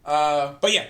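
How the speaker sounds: normal talking voice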